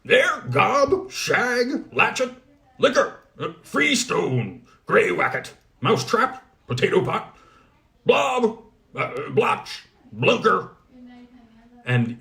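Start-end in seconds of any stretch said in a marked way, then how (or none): 0.0-10.7 much higher, louder calling of names. Acted. Goblin smith loudly calling his dwarfs out of the chimney. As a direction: summon the dwarfs one by one. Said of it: "called out a hundred odd names".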